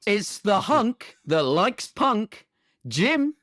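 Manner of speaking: slow, high hype introduction; hammed-up MC introduction; podcast announcer. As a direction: stretch the rhyme, lift the name up high. Announces cast member.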